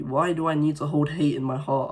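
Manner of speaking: ordinary talk, nothing marked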